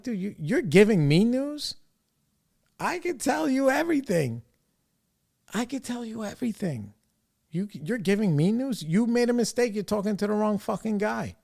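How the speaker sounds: high-pitched, incredulous comeback; exasperated retort. Retold or imitated. To the wedding planner: a high, incredulous retort. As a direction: pitch it up in disbelief, mocking her news. Retelling his reply to planner.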